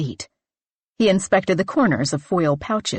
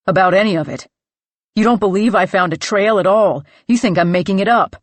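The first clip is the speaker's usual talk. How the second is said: louder, hurt and accusing, faster at the end; angry accusation; 6 dB louder, faster than usual. Acted. Clay angrily accuses his friends of disbelieving him. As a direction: throw it at them, feeling betrayed.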